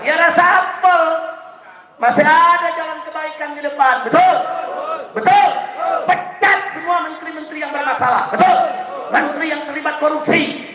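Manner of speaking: rabble-rousing list, each phrase pushed high; fiery rally preaching. Real, not acted. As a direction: fire up the crowd, pitched high and sustained. Preacher rallies the crowd.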